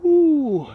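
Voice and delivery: high loud ooh; surprised ooh. Real, not acted. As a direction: let out a sudden high ooh. Coach reacts mid workout.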